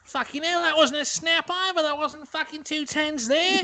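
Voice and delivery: high, exaggerated Aussie rant; mock Australian complaint; Australian accent. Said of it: "It's a great accent".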